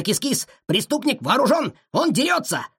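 high, agitated cry; alarmed warning. A narrator's reading. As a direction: warn a friend of danger, pitch jumping up. Dog warns the cat of danger. (взволнованное предупреждение, высоким голосом)

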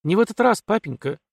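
firm objection starting high; resolute. A narrator's reading. Katerina contradicting papa, resolute. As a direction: open high and emphatic, settle at the end. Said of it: "решительно мотнула Катерина".

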